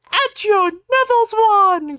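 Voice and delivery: high, loud, slow dark lord call; hammy villain spell. Acted. Voldemort summoning Neville's wand, hammed-up. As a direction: boom the spell like a panto villain. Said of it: "cried Voldemort".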